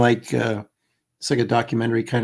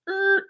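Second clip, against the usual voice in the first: high little "errr" effect; high-pitched sound effect; imitated noise; 3 dB quieter than usual. Shown as a demonstration. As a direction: make a high noise for the hill's steep turn. Imitating the hill's sharp climb.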